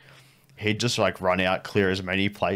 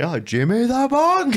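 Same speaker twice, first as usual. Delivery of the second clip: high, loud, slow call from afar; called across a crowd; 7 dB louder, slower than usual. Retold or imitated. Tall guy calling across the market crowd, high slow shout. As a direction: hail him over the market crowd. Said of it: "You just hear him say".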